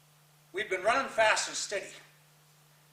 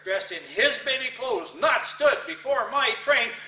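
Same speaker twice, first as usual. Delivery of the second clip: slow, higher and louder, each word weighed; slow emphatic climax; 4 semitones higher, 5 dB louder, slower than usual. Acted. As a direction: lean hard on the final image. Climax of the poem.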